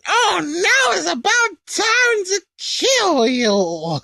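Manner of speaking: very high, slow, whiny sneer; gloating Babidi. Retold or imitated. Babidi gloating over the pinned Kai, high slow sneer. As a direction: savour the threat like a petty little wizard. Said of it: "Actually, sorry".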